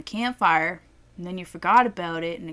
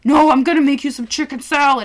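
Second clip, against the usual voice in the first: a louder, higher insistent dad; 5 semitones higher, 10 dB louder than usual. Retold or imitated. Dad insisting on making lunch at night, loud and firm. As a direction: insist like a stubborn father. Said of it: "And he's like".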